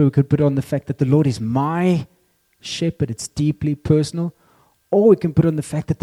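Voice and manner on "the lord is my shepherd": "my" held long, then a pause before "shepherd"; stress on my. Shown as a demonstration. Preacher showing it is deeply personal, stress on "my". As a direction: lean on "my" and pause after it. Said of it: "depending on where you put the emphasis".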